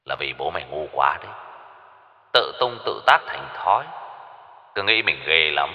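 soft, quick, scornful voice; contemptuous villain. A narrator's reading. Kidnapper scorning the captive's father, cold and soft. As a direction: dismiss him coldly.

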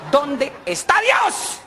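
high then slow dragging repeat; boy's mocking echo. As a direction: echo the priest, slowing down. Boy repeating question, fading.

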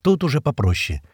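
ordinary delivery

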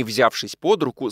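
usual speaking voice

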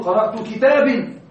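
كتاب pronounced "kitabin" as a wrong example; wrong genitive ending. Shown as a demonstration. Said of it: "لا تقل".

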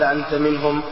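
ordinary delivery

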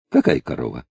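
low, feigned innocence; playing dumb. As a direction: innocent old man. Kuzma plays dumb. (притворное недоумение)

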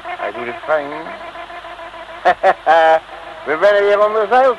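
ordinary talk

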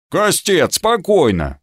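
a touch of merry shouting; cheerful shout. A narrator's reading. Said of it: "крикнул он весело".

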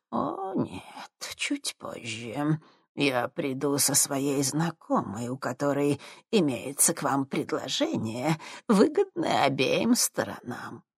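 put-on soft, slow menacing old woman; sinister crone. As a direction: purr it like a sinister old witch.

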